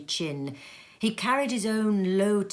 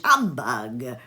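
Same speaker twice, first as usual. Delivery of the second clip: lower, louder, contemptuous snort of a word; gruff humbug; 6 semitones lower, 5 dB louder than usual. Acted. Scrooge scorning Christmas, low and contemptuous. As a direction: growl it dismissively.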